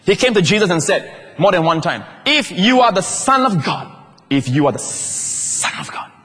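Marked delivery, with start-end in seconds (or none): 2.3-3.9 slow, higher, taunting challenge from the devil; devil's mocking dare. 4.3-6.1 slow hissed whisper of the tempter; the devil whispering his dare; sinister devil whisper. Acted. Repeating devil's words, hushed.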